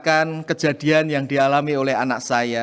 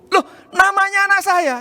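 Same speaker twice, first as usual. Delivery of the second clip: surprised; 13 semitones higher than usual. Real, not acted. Finds his daughter won first prize.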